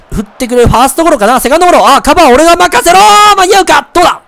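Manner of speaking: rising to a high, loud yell; frantic shout. Real, not acted. Pitcher racing to cover first, frantic rising shout. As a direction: call for the ball in mounting urgency. Frantic fielding play in game.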